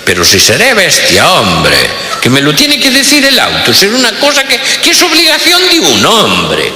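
pompous tycoon offended; exasperated rich boss. Retold or imitated. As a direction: rant against the bossy car. Boss fed up with car.

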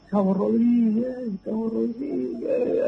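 high, slow drawn-out call for help; remembered cry for rescue, pitched high and stretched; retold desperate calling. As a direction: call up through the earth to the corporal. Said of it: "gritábamos".

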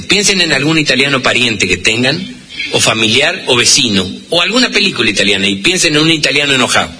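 normal talking voice

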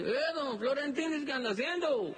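paisano santiagueño, voz mucho más aguda. Acted. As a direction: saluda cantadito, como viejo de campo. Samaniego responde asomándose. (Samaniego saluda a su visitante con voz aguda de paisano)

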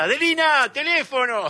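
high, loud call to a neighbour; shouted call. Retold or imitated. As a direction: imitate a shopkeeper yelling someone to the phone. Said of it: "que gritaba por el teléfono".

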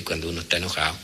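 ordinary talk

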